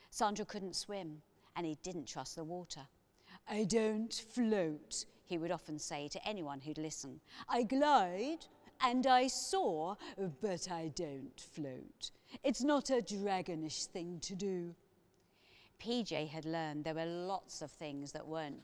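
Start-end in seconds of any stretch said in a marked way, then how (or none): 3.5-5.0 loud, ponderous dragon voice; slow and solemn, like a dragon; dragon, earnest and loud; Sandra the dragon. A narrator's reading. 7.5-14.7 loud, ponderous dragon voice; slow and solemn, like a dragon; dragon, earnest and loud; Sandra the dragon. A narrator's reading.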